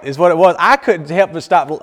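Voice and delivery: usual speaking voice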